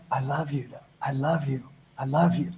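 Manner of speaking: whisper-soft, tender and sincere; soft sincere love. Shown as a demonstration. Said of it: "Can you look in the mirror and go".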